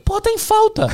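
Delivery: high, sneering repeat of the excuse; sarcastic mockery. Mocking the seller's excuse.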